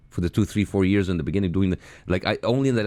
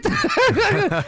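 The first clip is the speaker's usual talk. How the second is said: high squealing laugh; 5 dB louder than usual. Real, not acted. Squealing laugh after "What?.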